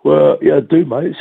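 talking through a yawn; stretched, yawning words. Real, not acted. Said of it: "Sorry about yawning".

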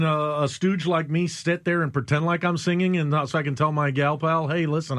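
normal talking voice

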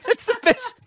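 can't stop laughing telling it. Real, not acted. Host laughing while telling co-hosts about his wife.

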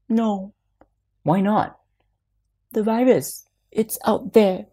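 put-on high little boy voice; worried child voice. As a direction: voice a small boy afraid of the virus. Son refusing, fearing virus.

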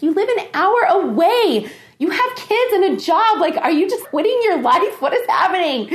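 high-pitched, exasperated mock scolding; incredulous protest. Woman retelling her protest to an over-eager date, incredulous.

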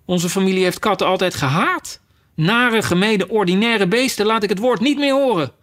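high, outraged tirade building at the end; indignant mouse. Acted. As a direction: protest with offended disgust. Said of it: "schreeuwde de muis".

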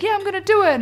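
high-pitched mock insistence; defiant mum. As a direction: imitate a mum stubbornly standing her ground.

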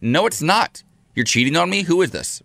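high, accusing protest; retold upset accusation. Retold argument with husband.

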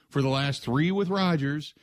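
ordinary talk

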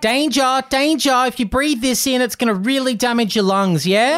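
high, anxious alarm voice; fussy fire alarm. Acted. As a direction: play an overeager smoke alarm.

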